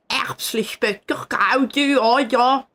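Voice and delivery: mocking Saint Gallen dialect; exaggerated Saint Gallen Swiss German; Saint Gallen accent. Shown as a demonstration. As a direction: imitate the Saint Gallen accent you hate. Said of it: "That's the accent they have".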